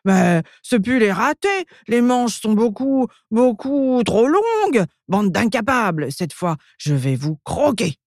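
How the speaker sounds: high-pitched ogress tantrum, stretched words; outraged cry. A narrator's reading. Ogress finding the sleeves too long, high outraged cry. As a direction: exclaim in rising exasperation, without real loudness. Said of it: "l'ogresse s'écrie".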